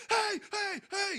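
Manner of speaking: high hey hey; retold yell. Neighbour yelling at the teens, high. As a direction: shout it at the kids. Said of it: "motherfuckers would be like".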